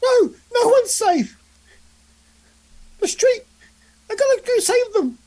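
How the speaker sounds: high, loud squeaky plea. Acted. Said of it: "with a really squeaky voice".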